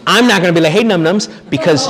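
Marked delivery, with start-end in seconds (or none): 0.7-1.3 higher, cheeky sing-song hello; mock cheery greeting. Priest mock-greeting a friend's wife by her pet name.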